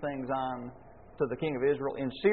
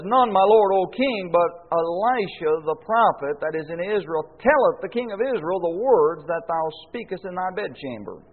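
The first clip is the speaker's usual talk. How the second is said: raised, louder formal reading of a Bible verse; scripture reading; 3 semitones higher, 8 dB louder than usual. Acted. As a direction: read the old King James words out with weight. Reads a Bible verse aloud.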